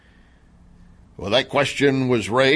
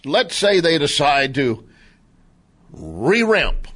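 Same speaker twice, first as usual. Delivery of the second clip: ordinary talk, nothing marked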